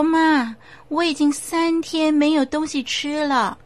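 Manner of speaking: put-on a hungry child spirit pleading; pleading child ghost.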